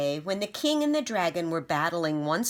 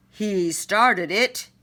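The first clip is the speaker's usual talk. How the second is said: slow, louder pleading accusation; accusing king; 4 semitones higher, 6 dB louder, slower than usual. Acted. King crying out blame at the dragon. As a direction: cry it out pointing at the dragon. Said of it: "he cried".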